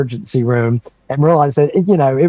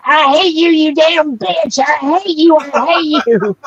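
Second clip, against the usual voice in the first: put-on childish furious scream; 13 semitones higher than usual. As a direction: scream it like a furious little kid stamping his feet. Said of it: "I started screaming and crying more".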